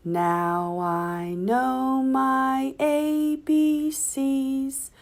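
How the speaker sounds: ordinary delivery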